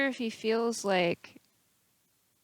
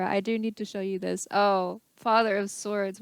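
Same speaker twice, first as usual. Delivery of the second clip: ordinary talk, nothing marked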